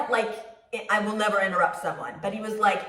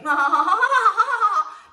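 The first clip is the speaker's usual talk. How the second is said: high, loud nervous laugh; awkward laugh; 11 semitones higher, 5 dB louder than usual. Retold or imitated. Laughing off her own fart joke, nervous high laugh. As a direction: laugh to cover the embarrassment. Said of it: "Tried to laugh it off".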